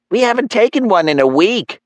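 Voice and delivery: high-pitched, eager mom voice; giddy mother. Acted. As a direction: gush it as an excited mom.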